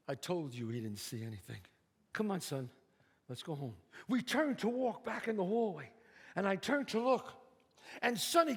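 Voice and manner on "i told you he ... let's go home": father shielding son from cop, low and hushed; father's low voice. Acted. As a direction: talk low and quiet like a protective dad.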